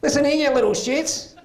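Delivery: put-on mother losing it at her nagging four-year-old, furious high yell; stressed mum snapping. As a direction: tear into the kid like a mum at breaking point. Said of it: "these words came out of her mouth".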